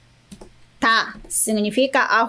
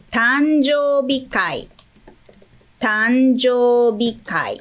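slow model word; slower than usual. Shown as a demonstration. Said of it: "O próximo".

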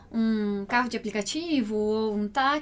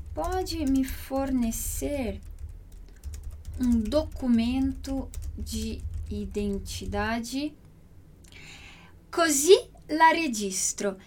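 slow teaching voice; much slower than usual. Shown as a demonstration. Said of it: "slow teaching repetition".

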